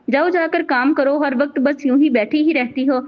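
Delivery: ordinary delivery, nothing marked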